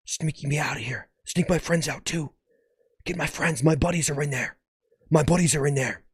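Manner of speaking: hushed, pleading whisper; urgent stage whisper; conspiratorial whisper. Pretend. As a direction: whisper a secret escape plan. Cheeseburger secretly pleading for escape.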